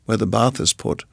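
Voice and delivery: plain reading voice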